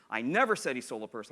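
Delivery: never punched above the other words; emphasize never as the contrasted word; stress on never. Shown as a demonstration. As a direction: hit the word never firmly. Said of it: "they have six different meanings".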